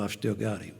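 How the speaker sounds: a tender, sincere aside; quiet pride. Real, not acted. Telling the audience he kept the boy, quietly moved.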